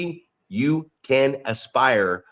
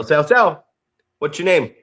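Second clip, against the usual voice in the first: usual speaking voice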